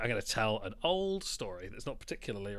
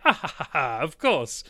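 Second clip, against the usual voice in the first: mock chuckle, a bit louder, brushing the request aside; laughing it off; 2 semitones higher, 7 dB louder than usual. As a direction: laugh like he thinks it's a joke.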